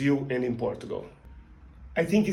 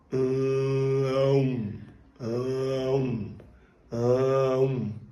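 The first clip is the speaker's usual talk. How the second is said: nasal hum to O; much slower than usual. Shown as a demonstration. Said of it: "Then you transit to O".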